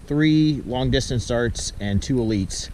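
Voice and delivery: usual speaking voice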